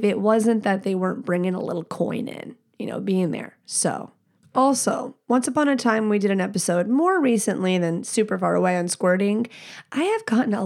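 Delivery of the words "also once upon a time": usual speaking voice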